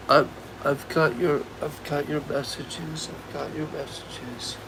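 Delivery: fading to a hushed, shaken murmur; quiet. Real, not acted. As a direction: say it softly, almost under the breath. Shaken reply after crying.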